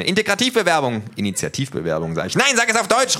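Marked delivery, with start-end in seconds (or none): 0.0-1.0 Integrativbewerbung yelled high and loud; wrong word shouted. Acted. Thug gets the word wrong. 2.3-3.2 high, loud furious shout; little thug screams at him; calling loudly. A narrator's reading. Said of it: "ruft er".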